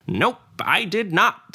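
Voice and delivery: high, slow, sing-song gnome reply; cheeky gnome. Acted. Gnome sorceress brushes off the paladin, high and slow. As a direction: tease him with a lilting no.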